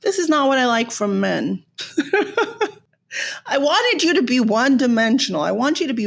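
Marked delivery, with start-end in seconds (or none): 1.7-2.7 short high-pitched giggle. Real, not acted. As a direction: burst into a quick giggle. Laughs at own comment.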